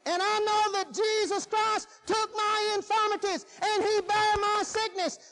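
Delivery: high-pitched preaching declaration, stretched out; forceful preaching. Acted. Preacher declaring his faith in healing, forceful preaching.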